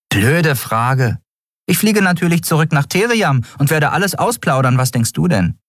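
high, cheeky character voice; sarcastic crew member. Acted. Nelen mocking the captain's question, high and sarcastic.

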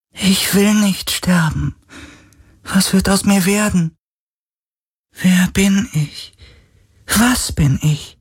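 high, slow ghostly voice; plaintive, drawn-out high wail of words; despairing spirit. A narrator's reading. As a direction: plead like a lost, dying otherworldly being.

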